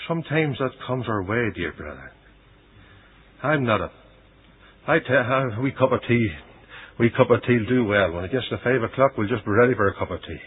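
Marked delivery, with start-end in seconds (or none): none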